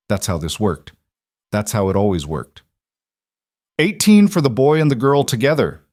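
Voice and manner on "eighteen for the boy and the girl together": loud high bid from the crowd; shouting. A narrator's reading. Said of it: "someone else shouted".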